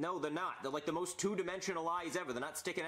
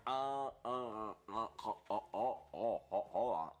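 mouth wide open; 6 semitones lower, slower than usual. Pretend. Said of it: "it looks like I'm big".

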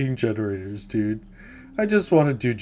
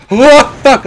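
sudden high yelp of a curse; panicked screamed curse; startled scream; 13 semitones higher, 18 dB louder than usual. Real, not acted. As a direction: shriek it as the killer jumps out. Jump scare by killer.

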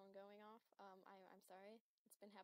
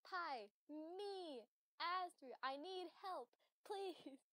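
mock plea; 6 semitones higher, 8 dB louder, much slower than usual. Joking about wanting pie.

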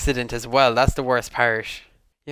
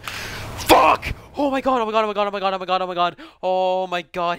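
shrieking jackpot winner; 6 semitones higher than usual. Real, not acted. Narrow escape in game.

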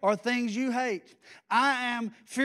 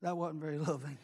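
quiet sheepish aside; 8 semitones lower, 10 dB quieter than usual. Real, not acted. Sheepish aside after scolding.

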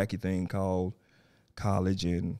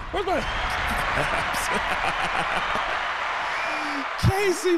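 excited calling. Real, not acted. Greeting arriving guest.

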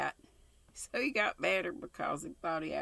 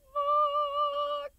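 squeaky, much higher disbelieving What; shocked squeal; 6 dB louder than usual. Real, not acted. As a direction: yelp in mock outrage at the kiss. Stunned reaction to the kiss.

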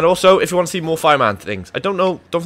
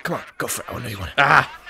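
ordinary talk, nothing marked

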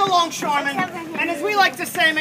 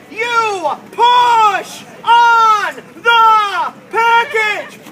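very slow stretched instruction; 5 semitones higher, 10 dB louder, much slower than usual. Acted. Drawn-out instruction.

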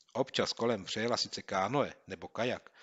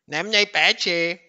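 much higher, louder, slow sneer; smirking reply; 10 semitones higher, 13 dB louder, slower than usual. Acted. Bully shrugging off the threat, smirking. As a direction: smirk as you say it. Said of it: "ušklíbil se bičiště".

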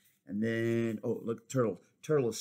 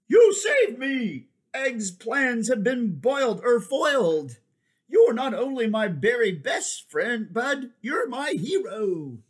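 grateful waffle; 11 semitones higher, 8 dB louder than usual. Acted. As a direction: gush over your hero. Waffle thanks Strawberry.

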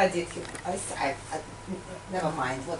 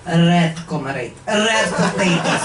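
put-on Russian accent; slower than usual. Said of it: "KGB comedy act".

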